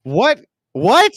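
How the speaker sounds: loud, high disbelieving what; shocked. Real, not acted. Shocked reaction to insult.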